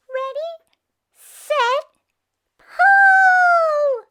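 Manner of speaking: high bat voice, building to a loud shout; rally everyone to pull; high, loud squeaky call building up. Acted. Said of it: "called the bat".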